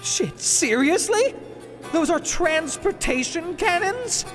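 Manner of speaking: high-pitched astonished questions; incredulous. Acted. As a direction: react in startled disbelief. Learns cannons are for transport.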